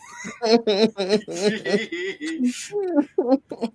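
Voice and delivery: loud, high burst of laughter; big laugh. Real, not acted. Player cracking up over a joke with friends.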